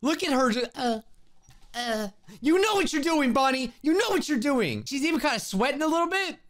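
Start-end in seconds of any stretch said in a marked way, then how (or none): 2.4-4.8 bright, higher cheering; encouraging. Real, not acted. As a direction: cheer her on. Cheering on game character.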